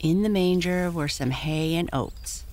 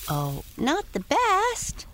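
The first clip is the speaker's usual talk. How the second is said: high, slow modest pony; modest protest; 14 semitones higher, slower than usual. Acted. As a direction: wave it off humbly. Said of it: "protested Prancer modestly".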